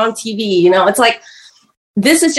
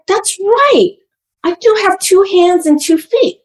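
high, bright self-pep talk; rally yourself like a small kid; a child talking themselves into being fine; child resolving bravely; 9 semitones higher, slower than usual. Retold or imitated. Child voiced bucking up.

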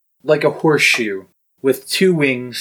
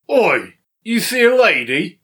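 loud-calling guard, pitched up; confused guard; 8 semitones higher, 3 dB louder than usual. Acted. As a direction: call out as a puzzled guard. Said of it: "the guard comes out and is very confused".

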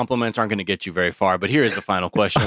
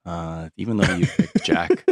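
usual speaking voice

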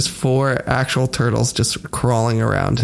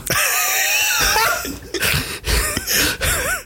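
long shrieking laugh. Real, not acted. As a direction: crack up at your own idea. Laughing at own glitter bomb joke.

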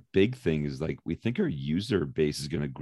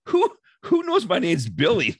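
confused referee; 11 semitones higher, 3 dB louder than usual. Retold or imitated. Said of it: "he looked in the stands saying".

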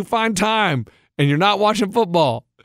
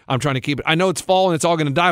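usual speaking voice

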